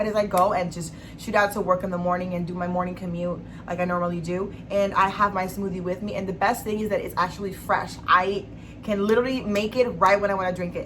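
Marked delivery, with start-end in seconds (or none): none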